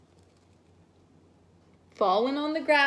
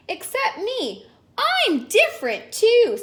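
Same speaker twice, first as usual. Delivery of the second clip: high character voice; 10 semitones higher, 4 dB louder than usual. Acted. Portia and Caramba dialogue.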